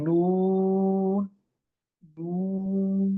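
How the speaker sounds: নুন stretched very slowly with a long nasal ন; drawn-out nun. Shown as a demonstration. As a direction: hum the নুন long through the nose. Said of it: "এটা হলো উচ্চারণ".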